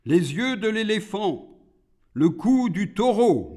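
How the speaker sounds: high-pitched list of demands; demanding demon. A narrator's reading. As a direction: call each item out high, as Iblis. Iblis lists demands to God.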